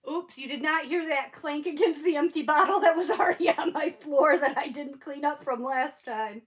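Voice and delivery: normal talking voice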